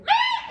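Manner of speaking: sudden high shrieked yell; angry yell. Retold or imitated. Student yelling at her mother on the phone, shrieked. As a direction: let out a high angry scream. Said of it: "she yell at her mom".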